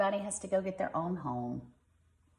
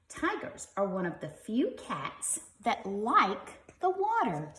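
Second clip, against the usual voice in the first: lively, bright storybook reading; reading to children; 4 semitones higher than usual. Acted. Parent reading a tiger book to kids, lively storybook voice. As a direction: read it like a bedtime picture book.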